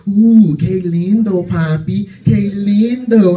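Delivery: imitating a flirty woman, drawn-out and slow. A woman admiring the comic at the store, imitated slow flirting. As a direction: coo it in slow mock-Spanish flirtation.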